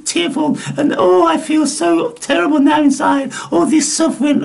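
high-pitched, hammed-up suffering; exaggerated parody of tearful feelings; mock emotional wailing. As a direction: whine it like a melodramatic sufferer. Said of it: "Emotions do".

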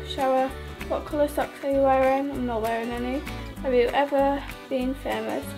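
normal talking voice

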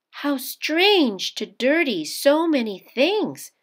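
put-on young Japanese bride, puzzled; mother's voice. A narrator's reading. Mother's voice in story.

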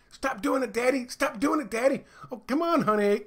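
put-on high, whiny child begging Daddy to stop; pleading kid. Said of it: "his family would stab him".

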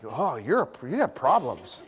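low mock-disapproving tut; judging audience. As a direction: tut at him from the pews. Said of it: "the other half is like".

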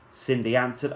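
ordinary delivery

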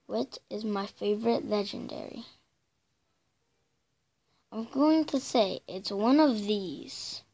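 ordinary delivery

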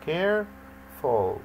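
"Careful" in two drawn-out syllables, care, ful; split into syllables. Shown as a demonstration. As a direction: break "Careful" slowly into care - ful with a gap. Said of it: "يعني فيها two syllables".

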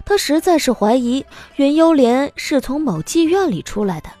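ordinary delivery

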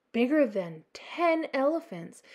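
usual speaking voice

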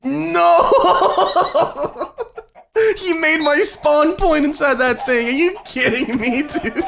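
high, loud, half-laughing protest; outraged wail. Real, not acted. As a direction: cry out in comic disbelief. Gamer trapped by a spawn prank.

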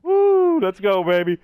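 loud, high victory whoop; excited cheer. Real, not acted. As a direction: celebrate the kill at full volume. Celebrating a kill.

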